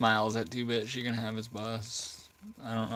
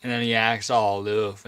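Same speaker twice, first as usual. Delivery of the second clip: normal talking voice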